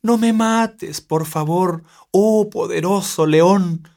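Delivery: agudo, lento y suplicante; un ratoncito que ruega por su vida; súplica de ratón. Acted. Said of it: "suplicaba el pequeño ratón". (ratoncito suplicante, agudo y lento)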